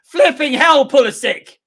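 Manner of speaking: high, loud cry with the swear swapped for flipping; loud clean oath. Real, not acted. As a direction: shout it, now cleaned up. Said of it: "How shall I say that?".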